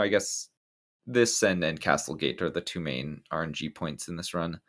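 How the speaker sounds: ordinary delivery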